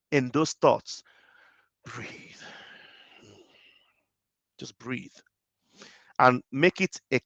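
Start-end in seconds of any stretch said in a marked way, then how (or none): 1.8-5.1 soft breathing cue. Acted. Guiding breath.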